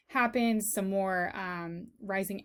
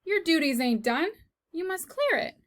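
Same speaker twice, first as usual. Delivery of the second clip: bossy; 7 semitones higher than usual. Acted. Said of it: "said Cook".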